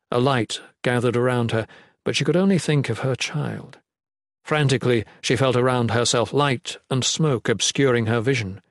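usual speaking voice

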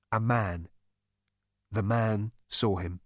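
plain reading voice

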